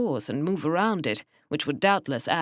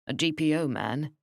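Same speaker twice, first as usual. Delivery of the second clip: plain reading voice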